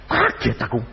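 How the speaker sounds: ordinary talk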